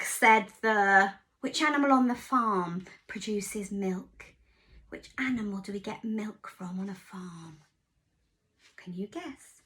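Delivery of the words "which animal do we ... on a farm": plain reading voice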